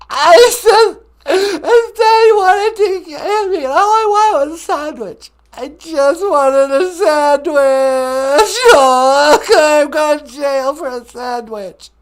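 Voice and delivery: high, loud, hammed-up crying wail; mock Jussie Smollett. Prank caller sobbing to the radio host, high mock wail. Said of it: "Jussie Smollett is on the telephone".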